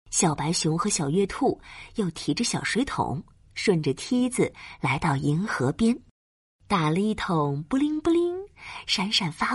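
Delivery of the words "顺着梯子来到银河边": usual speaking voice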